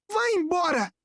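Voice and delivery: put-on high, strained boy's cry; acted angry yell, pitched high; shouted order. A narrator's reading. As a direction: drive him away with a high outburst. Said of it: "gritou ele".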